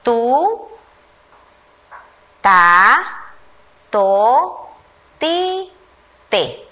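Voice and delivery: put-on slow, separate syllables read aloud for children; syllable drill. Shown as a demonstration. Teacher leading first graders through the T syllables, each one drawn out. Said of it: "Observemos cuál es la vocal".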